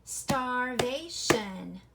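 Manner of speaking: "Starvation" very slow and loud, in three beats; clapped-out syllables. Shown as a demonstration. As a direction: clap out "Starvation" as star, vay, shun. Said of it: "Let's clap it out".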